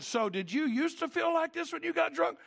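high-pitched, insistent retold question; eager questioning. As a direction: ask it high, leading towards a point.

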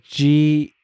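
G said slowly as a letter; spelling out. Shown as a demonstration. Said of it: "is how you'd say it".

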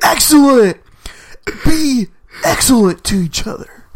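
high, loud surfer-dude proclamation; exaggerated, belted bro voice; Bill and Ted. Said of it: "Bill and Ted's Excellent Adventure".

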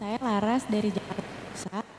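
distant, faint voice off mic; speaking too far from the microphone. Real, not acted. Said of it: "bisa lebih keras suaranya".

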